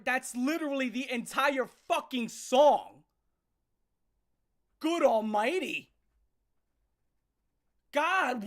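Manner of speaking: loud, disgusted rant; exasperated outburst. Real, not acted. Exasperated rant after mocking the song.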